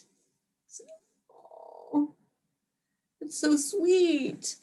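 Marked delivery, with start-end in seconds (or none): 1.3-4.6 put-on higher, slow and soft, melting at the child; a touched mom answering her daughter's love; mock touched. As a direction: gush softly like a touched mom.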